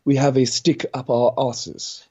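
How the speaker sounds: mock Queen's English; posh British accent. Shown as a demonstration. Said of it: "everyone speaks like this".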